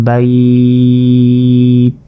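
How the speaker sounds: "বাইত" with a very long "ai" before the final t; slowly drawn-out "বাইত", stopped on t; elongated waqf. Shown as a demonstration. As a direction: stretch the vowel of "বাইত" on a level note. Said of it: "সাকিন হিসাবে এই তাকে".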